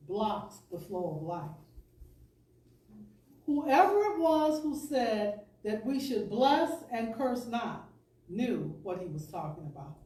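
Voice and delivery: usual speaking voice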